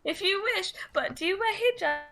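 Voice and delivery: high, put-on tiptoeing voice; mock someone delicately prefacing a personal question; imagined personal-question asker. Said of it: "that's how it sounds in my head".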